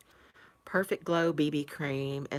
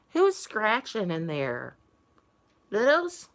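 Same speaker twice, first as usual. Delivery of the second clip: calling the cats; 3 semitones higher, 7 dB louder, slower than usual. Real, not acted.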